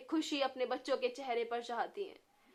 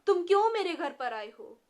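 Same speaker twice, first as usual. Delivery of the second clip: loud, higher frightened questions; scared questioning; 6 semitones higher, 8 dB louder than usual. A narrator's reading. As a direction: demand answers out of fear. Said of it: "Shamu भी बहुत डर गया था".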